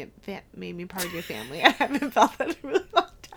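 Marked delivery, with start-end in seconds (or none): 1.6-3.4 laughing through tears. Real, not acted. Quoting speech while crying.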